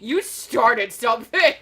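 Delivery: loud, slow, laughing accusation; mock accusation. Fortune teller to the card's owner, playfully accusing. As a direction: draw it out loudly with a laugh.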